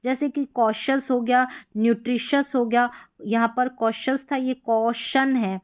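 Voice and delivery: ordinary talk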